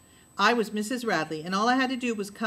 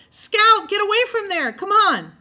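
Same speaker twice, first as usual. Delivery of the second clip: high, loud frantic calling; a boy shouting a warning to his sister; urgent shout; 12 semitones higher, 10 dB louder than usual. A narrator's reading. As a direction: yell at her to get away. Jem calls urgently to Scout.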